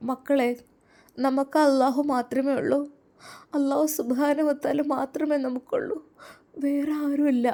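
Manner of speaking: high, plaintive voice; tearful mother. Acted. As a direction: lament gently to the children. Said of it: "ഉമ്മച്ചി എന്തിനാ കരയുന്നത്".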